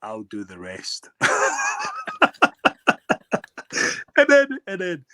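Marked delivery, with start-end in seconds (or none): 0.0-1.1 quiet cocky aside. Retold or imitated. Cocky promise. 1.2-4.1 long high burst of laughter; big laugh. Real, not acted. Player laughing at his own tale.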